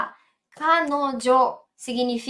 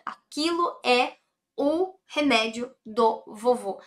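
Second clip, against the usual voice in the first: Aquilo é o remédio do vovô said slowly, word by word; deliberate teacher pacing; slow, careful translation. Shown as a demonstration. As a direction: spell out the sentence for the student. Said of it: "translation said twice".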